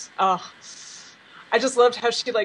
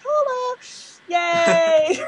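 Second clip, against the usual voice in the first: much higher, gleeful cheer; excited cheer; 10 semitones higher, much slower than usual. Retold or imitated. Fan relieved her pony is innocent, high gleeful cheer. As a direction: cheer like your favorite is innocent. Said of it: "I was like".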